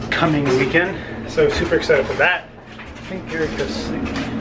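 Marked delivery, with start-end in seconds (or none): 2.7-4.4 real hushed whisper, voice dropped to breath. Real, not acted. Whispering near his sleeping wife, hushed and breathless. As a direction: keep it down so she doesn't wake.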